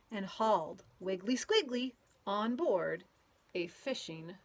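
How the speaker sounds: lively storybook read-aloud with playful sound words; reading to children. A narrator's reading. Teacher reading a picture book to children, lively and playful. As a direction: read it out to a group of kids, bouncing along.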